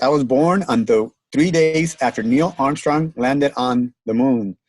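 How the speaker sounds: ordinary talk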